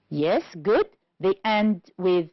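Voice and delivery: normal talking voice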